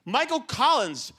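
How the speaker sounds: high-pitched, emphatic outrage; comic indignation. Acted. Comedian laments the forgotten third guy, indignant. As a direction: lift it high in aggrieved disbelief.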